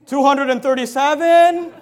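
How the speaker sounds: loud, high, drawn-out counting; mock endless count. Mocked parent still counting at Billy, loud and exasperated. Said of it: "how long are you gonna count for".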